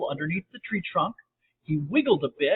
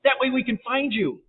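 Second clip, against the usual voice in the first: high, raised calling voice; screamed; 7 semitones higher than usual. A narrator's reading. James searching for Sally, high calling-out. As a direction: call it out to her. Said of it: "James screamed".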